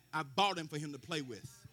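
normal talking voice

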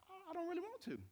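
high, soft reluctant reply; a hint of sulky pleading; reluctant whine. Man answering the Holy Spirit, mock reluctant whine.

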